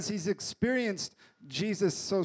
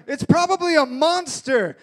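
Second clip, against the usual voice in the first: nervous disciple; 5 semitones higher, 8 dB louder than usual. Retold or imitated. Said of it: "And they're like".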